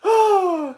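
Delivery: high wailing laugh; worn-out helpless laughter; real laughing fit. Real, not acted. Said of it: "I'm crying".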